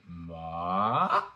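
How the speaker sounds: long rising MA, like running uphill; second tone. Shown as a demonstration. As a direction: start MA down low and climb to a peak. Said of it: "That is the second tone".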